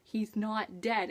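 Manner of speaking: soft and slow, refusing to accept it; hushed denial. Retold or imitated. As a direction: quietly plead that he's not dead. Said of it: "And I was just like".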